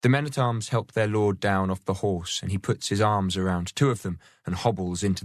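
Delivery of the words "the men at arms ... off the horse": ordinary delivery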